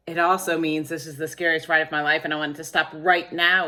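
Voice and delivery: fast, loud rattle of words; hurried explanation. A narrator's reading. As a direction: race through it emphatically. Repeats the frantic phrase as explanation.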